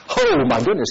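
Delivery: high-pitched startled oh my goodness; a boy caught off guard, pitched high. Acted. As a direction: gasp it out as a shocked little boy. Boy's surprise at killing duck.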